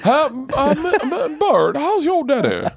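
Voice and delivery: very high, slow Carolina drawl; Southern buddy's dad. Acted. Buddy's dad asks Bert after his father, high slow mock Southern accent. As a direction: draw it out like a rich Southern older man. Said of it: "was that guy".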